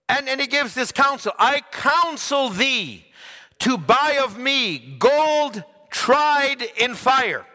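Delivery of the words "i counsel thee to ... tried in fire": very slow, ringing scripture; preaching. Acted. Preacher proclaiming Revelation to the church, slow and ringing. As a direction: draw out every word of counsel.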